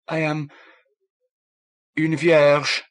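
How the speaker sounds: put-on shy French-accented confession. A narrator's reading.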